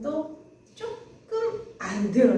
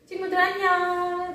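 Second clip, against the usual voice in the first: 신나는 인사; 7 semitones higher than usual. Real, not acted. 아이들에게 밝게 인사.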